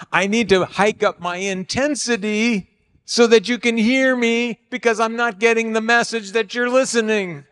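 mock insistent, high-pitched push; hiked-up intensity. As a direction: push hard to be heard. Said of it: "there's a version of that for couples".

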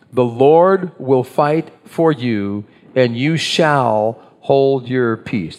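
"shall" drawn out slowly in a deliberate reading; stress on shall. Shown as a demonstration. Pastor leads the congregation in the verse, stressing "shall". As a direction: read slowly and lean long on "shall". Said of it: "Did you notice I emphasized the word shall?".

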